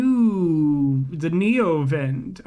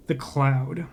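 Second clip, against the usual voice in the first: ordinary delivery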